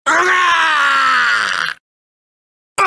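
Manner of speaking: very long falling scream; pained scream. Acted. Fighter hit in battle, drawn-out scream. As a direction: scream it out until it fades.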